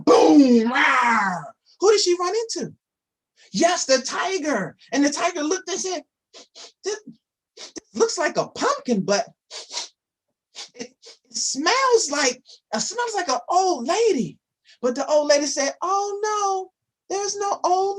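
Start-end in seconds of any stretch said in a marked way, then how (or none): 0.0-0.7 put-on a booming crash for the kids; sudden loud boom; loud boom effect. Pumpkin crash sound effect. 6.8-14.4 a hint of a puzzled tiger, a bit higher; sniffing, wondering tiger; slightly raised storybook tiger voice; lightly voiced curious tiger.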